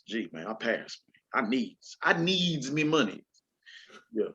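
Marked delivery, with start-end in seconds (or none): none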